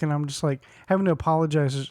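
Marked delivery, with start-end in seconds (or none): none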